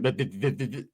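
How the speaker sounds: low-pitched stammer on one sound; stuttering like him. Real, not acted. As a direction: get stuck repeating the first sound. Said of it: "I started to talk like him".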